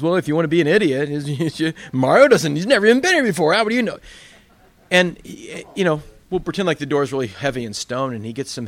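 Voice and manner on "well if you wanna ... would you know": mock the friend as an idiot, loudly; scornful Terry. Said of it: "Terry goes".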